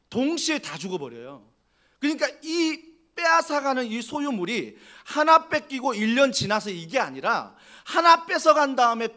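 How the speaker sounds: loud, driving preacher's build; full-force preaching. Acted. Pastor stirring the congregation, loud full-force preaching. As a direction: hammer each loss home to the congregation.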